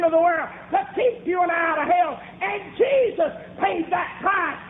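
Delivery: high, climbing pulpit cry; full-force preaching. Real, not acted.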